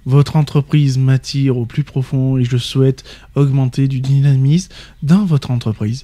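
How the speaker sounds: slow, listless mock motivation; limp candidate. Shown as a demonstration. Co-host plays a candidate claiming motivation, slow limp voice. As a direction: claim dynamism with no energy at all. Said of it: "s'il le dit d'une voix trop molle".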